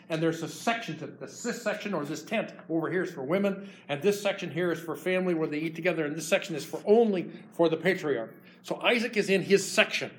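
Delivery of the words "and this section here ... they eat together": ordinary talk, nothing marked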